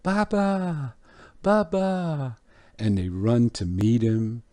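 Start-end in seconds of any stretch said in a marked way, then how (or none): 0.0-2.4 put-on high-pitched child calling out to Papa; screaming little boys. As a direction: imitate small boys' excited cries, voice pitched way up. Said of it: "and they're screaming".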